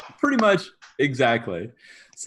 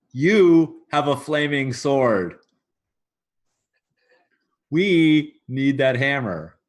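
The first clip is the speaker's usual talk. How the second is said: slow, drawn-out YOU and WE; emphatic insistence; 4 dB louder, slower than usual. Acted. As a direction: lean hard and slowly on "You" and "We". Quartermaster confronts the god over hammer.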